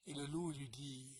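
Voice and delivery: plain reading voice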